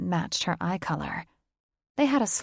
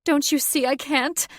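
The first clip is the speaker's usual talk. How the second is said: higher, louder anguished cry; shrill desperate; 8 semitones higher, 5 dB louder than usual. Acted. Catherine pleads with Thaddeus, high and desperate.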